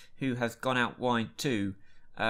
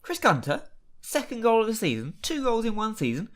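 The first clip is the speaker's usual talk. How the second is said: excited disbelief; 8 semitones higher, 4 dB louder than usual. Real, not acted. Surprise goal.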